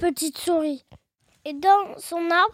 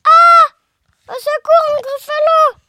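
high, loud cry for help; cri de frayeur joué; 8 semitones higher, 8 dB louder than usual. Acted. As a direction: scream out in mock terror. Mouse meets the Gruffalo. (cri aigu et affolé)

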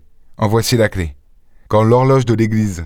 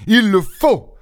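forceful outburst, pitch leaping high; violent exclamation; 11 semitones higher, slower than usual. A narrator's reading. Lupin stamping his foot at the young woman, violently insistent. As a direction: exclaim it with sudden vehemence. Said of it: "s'écria Lupin en frappant du pied et avec une véritable violence".

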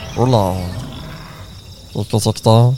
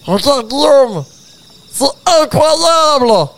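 excited wonder; 8 semitones higher than usual. Acted. Arriving in the Jurassic world.

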